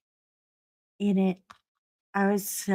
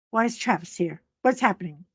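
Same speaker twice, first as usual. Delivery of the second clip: ordinary delivery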